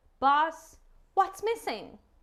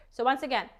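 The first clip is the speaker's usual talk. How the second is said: ordinary delivery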